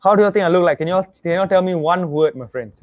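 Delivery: ordinary talk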